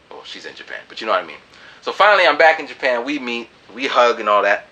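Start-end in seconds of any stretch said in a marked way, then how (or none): none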